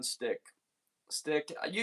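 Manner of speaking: ordinary talk, nothing marked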